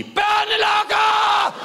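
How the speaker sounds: high, drawn-out shrieked accusation; child's scream. Retold or imitated. Comedian imitating the girls screaming at him, high shriek. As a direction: scream it like a scared kid. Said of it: "så skriger de".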